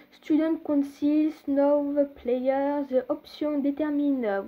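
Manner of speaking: slow English reading in a French accent. Real, not acted. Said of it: "Oh mon accent anglais". (anglais lu lentement avec un accent français)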